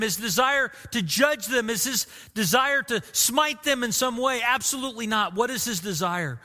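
ordinary delivery

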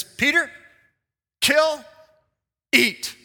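a slow spaced command from above; commanding voice of God. Acted. God commanding Peter, loud and spaced.

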